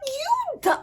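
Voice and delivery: high, loud exasperated squeal; mock outraged yell. Yelling in disbelief at a friend's excuse, high and loud. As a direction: shriek it in disbelief at her.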